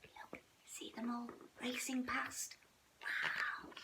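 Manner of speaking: whispered and hushed; soft whisper. A narrator's reading. Mother showing the cub the elk, whispered. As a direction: hush them in wonder.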